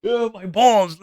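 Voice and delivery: high, exaggerated moan of agony; hammed-up pained whine; mock groaning in pain. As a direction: imitate someone wailing over an injury. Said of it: "pacing around and going like".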